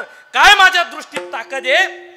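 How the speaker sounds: proud boasting. Acted. Sadhu marvelling at his own power over the heron, proud boasting. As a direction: puff it up with a touch of anger.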